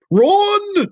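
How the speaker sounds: high frantic yelling warning; panicked shout. Acted. Father panicking on the phone.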